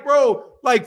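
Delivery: ordinary talk, nothing marked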